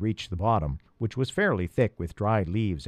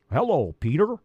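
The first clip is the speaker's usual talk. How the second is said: higher croaky frog greeting; croaking; 5 semitones higher than usual. Acted. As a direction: croak it like a sleepy old frog. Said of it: "he croaked".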